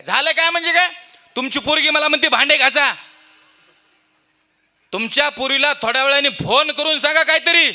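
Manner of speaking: loud high scolding; angry rant. Retold or imitated. Preacher scolds father-in-law over the phone, loud. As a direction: scold him for his daughter.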